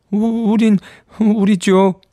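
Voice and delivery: put-on high, hesitant child's voice; stammering boy. A narrator's reading. Boy stammers an answer to nursemaid.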